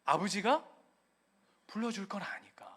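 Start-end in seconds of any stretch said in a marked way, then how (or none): 1.7-2.7 soft whispered 불러줄 걸 아니까. Real, not acted. Pastor hushing the congregation, whispered. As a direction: breathe it like a secret. Hushed punchline.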